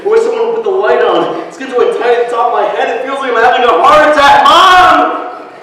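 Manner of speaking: fast, building from tense to a high scream of Mom; rising panic. Acted. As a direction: panic climbing until he cries out for his mother. Boy panicking in the dark.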